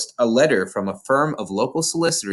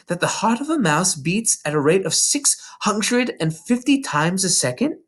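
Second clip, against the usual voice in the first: put-on lightly raised little girl voice; Matilda's voice; 8 semitones higher than usual. A narrator's reading. Narrator voicing young Matilda to her teacher, small girl voice. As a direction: voice a bright, earnest young girl.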